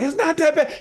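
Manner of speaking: high, loud mocking wife voice; mimicking wife. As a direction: play her dismissive in a high voice. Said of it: "And she's like".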